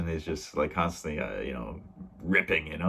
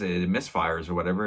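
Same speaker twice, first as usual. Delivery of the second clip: ordinary talk, nothing marked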